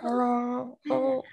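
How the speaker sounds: mock drained, feeble greeting; low energy. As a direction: imitate a hungover friend with no energy. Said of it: "your energy level was really low".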